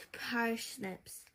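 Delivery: breathy hushed aside on one word; whispered word in a read-aloud. A narrator's reading. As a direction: drop to a secret whisper. Narrator drops to whisper.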